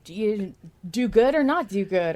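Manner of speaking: hammed-up narrator voice laying out the choice; imitating Booth John. To the co-host, mock announcer voice of Booth John. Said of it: "him being like".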